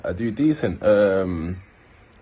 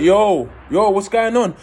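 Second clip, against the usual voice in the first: higher, louder frantic yo; frantic call; 9 semitones higher, 3 dB louder, slower than usual. Retold or imitated. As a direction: shout down the phone in alarm. Frantic call after stabbing.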